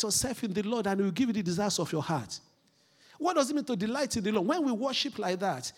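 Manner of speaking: loud, high preaching push; fervent preaching. Acted. Preacher urging church to get excited about Jesus, fervent. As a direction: rouse the congregation with fire. Preacher opening with full force.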